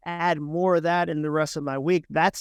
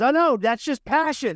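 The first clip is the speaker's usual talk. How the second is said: defensive angry man; 8 semitones higher, 3 dB louder than usual. Retold or imitated. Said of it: "He goes".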